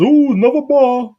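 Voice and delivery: high, slow sarcastic ooh; mock awe. Real, not acted. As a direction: fake impressed at a cheesy line. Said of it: "mocking the line".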